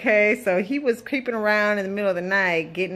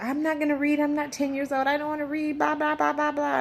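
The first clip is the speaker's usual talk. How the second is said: higher mock whine of a teen; whiny son; 7 semitones higher than usual. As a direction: lightly mimic the son's complaining. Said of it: "he was like".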